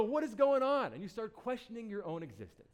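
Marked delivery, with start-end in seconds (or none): 0.0-0.9 much higher and louder, hammed-up disbelief; mock panicked outburst. Poster baffled by no likes: hammed-up panicked cry. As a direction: throw up your hands in fake alarm. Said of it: "You go".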